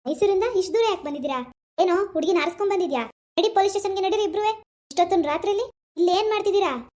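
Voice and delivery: loud police interrogation; accusing, forceful and insistent; loud, bullying questioning. Acted. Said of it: "ಯಾಕ್ ಜೋರಾಗ್ ಮಾತಾಡ್ತೀರಾ?".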